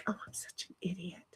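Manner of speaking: breathless whisper, no voice at all; whispered self-scold. Real, not acted. Scolding herself in a whisper after a mistake. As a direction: hiss it to yourself like a muttered kick.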